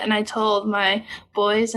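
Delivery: usual speaking voice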